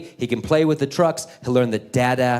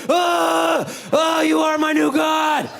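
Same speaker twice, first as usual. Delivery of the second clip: worshipful shout; 14 semitones higher, slower than usual. Retold or imitated. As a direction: cry out to the truck like it's a deity. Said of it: "as worshipful".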